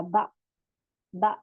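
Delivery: बा cut off with a glottal jolt; jerked ba. Shown as a demonstration. Teacher repeats बा with the jerk for the student. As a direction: snap बा shut with a sharp stop. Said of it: "थोड़ा झटका दिया जाएगा".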